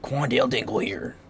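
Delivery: mock radio announcer introducing himself; radio announcer impression. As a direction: put on an announcer voice for a meme. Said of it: "some radio ass announcer".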